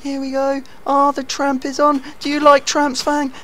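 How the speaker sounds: high mocking taunt. Player teasing Fang about the tramp, high mocking. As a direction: needle your friend with it.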